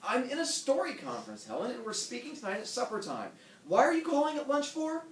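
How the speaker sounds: high, irritated husband snapping; husband irritably brushing off his wife's call; hostile. Acted. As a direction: bite back at the wife with annoyance. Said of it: "Hostile, hostile".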